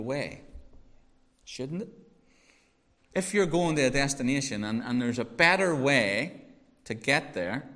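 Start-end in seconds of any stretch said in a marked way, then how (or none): 4.8-7.7 better drawn out with a rising lift, slowly; stress on better. Shown as a demonstration. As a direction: lean on the word better to make the point. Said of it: "and I stress the word better".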